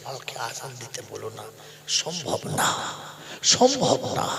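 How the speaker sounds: understated pitiful tone; plaintive refusal. Acted. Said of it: "Belal করুণ সুরে বলছে".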